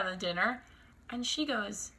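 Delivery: ordinary talk, nothing marked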